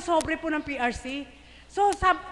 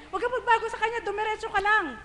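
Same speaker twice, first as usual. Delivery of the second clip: higher, laughing repeat of "Huwag ka pagbago sa Kanya"; a higher, laughing insistence; emphatic with a laugh; 7 semitones higher than usual. Real, not acted. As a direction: press the point home, beaming. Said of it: "repeated for emphasis".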